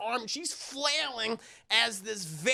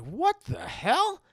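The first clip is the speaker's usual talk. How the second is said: slow, high, drawn-out what the hell; baffled; 8 semitones higher, much slower than usual. Retold or imitated. Mother finds batteries in the box, slow puzzled disbelief. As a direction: stretch out the disbelief.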